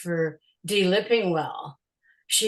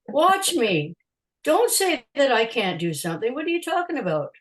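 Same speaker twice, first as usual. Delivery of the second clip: raised-pitch defiance, acted out; indignant retort; 5 semitones higher than usual. Photographer telling the promoter not to doubt her, indignant. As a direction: push back hard at the doubter.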